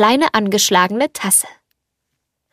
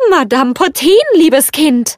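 higher, friendly teapot lady's call; 5 semitones higher, 4 dB louder than usual. A narrator's reading. Teapot calls to the girl through the door, friendly and lifted. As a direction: announce yourself kindly, pitched up. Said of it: "da die Stimme so freundlich klang".

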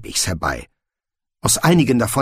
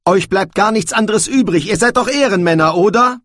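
loud, high exasperated challenge; shouting; 10 semitones higher, 5 dB louder than usual. A narrator's reading. Young man challenges both men's honour, shouting. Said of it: "dass er geschrien hatte".

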